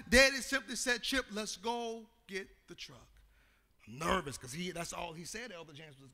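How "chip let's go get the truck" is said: calm father. Retold or imitated.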